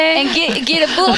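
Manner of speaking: put-on southern accent. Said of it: "the southern accent".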